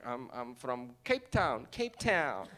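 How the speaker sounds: slow, hesitant reply; careful answer. Retold or imitated. Comic answering puzzled Americans, slow and careful. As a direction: spell it out slowly for a foreigner.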